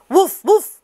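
very high, loud false alarm; high loud fake alarm call; alarmed shout. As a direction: yell fire to scare the birds. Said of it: "he cried".

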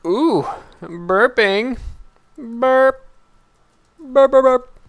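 loud, high goofy cartoon voice; silly high voice. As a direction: squeal the burps out comically. Silly voice for burping item.

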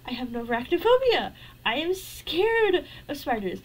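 normal talking voice

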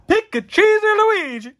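high, loud and eager; excited high voice. Real, not acted. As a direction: squeal the question out. Excited card reveal.

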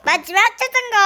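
loud laughing call. Real, not acted. Cheering on the last round. (웃으며 크게 외치기)

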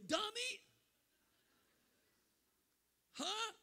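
mocking himself. Real, not acted. Preacher laughs at his own bravado, yelping a high self-mock. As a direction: call yourself a fool with a grin. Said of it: "dumb me, I'm so stupid".

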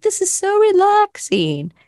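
high, airy imitation of a foreign instructor's accent; parroting the Skillshare lady in her foreign accent; mock foreign accent. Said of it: "she said it with a different accent".

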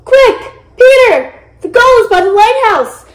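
high, loud alarm; urgent calling. A narrator's reading. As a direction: shout to rally a brother to the rescue. Urgent shout while running to rescue.

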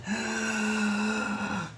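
long, soft high gasp; awed gasp. Acted. Burglar awed by the painting's moons, awed gasp. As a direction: breathe in slowly in wonder.

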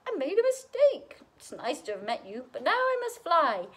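high, flustered dragon apology; spluttering dragon. A narrator's reading. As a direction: back away apologising as the dragon. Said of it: "he spluttered".

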